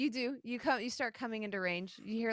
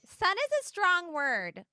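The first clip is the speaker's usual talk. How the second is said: higher, slow goddess voice; Ahavara; 8 semitones higher, 4 dB louder than usual. Acted. Turtle goddess hedging about her follower, slow higher voice. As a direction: draw it out in a higher-pitched character voice. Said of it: "You hear Ahavara say".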